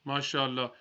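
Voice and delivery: usual speaking voice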